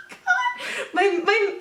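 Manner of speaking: squealing laugh, very high; high laughing fit. Real, not acted. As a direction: crack up helplessly. Pills spilled, laughing fit.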